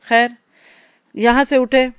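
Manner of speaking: ordinary delivery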